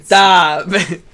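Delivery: a loud, high laughing "stop!"; laughing shout. Real, not acted. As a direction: burst out in a laughing protest. Friend mocks him, he protests.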